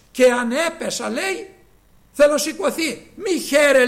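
loud defiant declaration with a laugh in it; triumphant cry. Acted. Preacher quoting the fallen believer's defiance, loud and triumphant. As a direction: proclaim rising again, loud and unbowed. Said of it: "λέγει η εχθρά μου".

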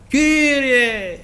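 long high cry to the Lord; drawn-out plea from afar; calling out. Retold or imitated. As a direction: call out, stretching the word and pitched well up. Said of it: "εφώναξε".

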